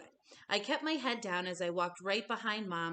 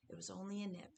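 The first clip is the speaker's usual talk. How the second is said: soft, hushed whisper into an ear; mother whispering to her son in bed, softly; 8 dB quieter, faster than usual. A narrator's reading. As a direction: breathe it close and soft. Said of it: "she whispered in my ear".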